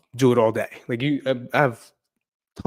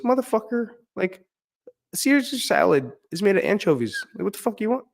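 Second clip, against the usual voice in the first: pitched way up, exasperated rant; real exasperation boiling over at the customer; angry outburst; 11 semitones higher than usual. Real, not acted. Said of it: "Sorry for getting angry".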